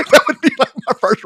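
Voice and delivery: high, loud words through laughter; talking through a real laugh. Real, not acted. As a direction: crack up while trying to talk. Cracks up at own idea.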